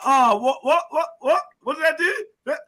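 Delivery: high-pitched, rapid-fire what-what-what; retold alarmed questioning. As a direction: imitate your own worried disbelief, voice climbing. Retelling his anxious reaction to blame.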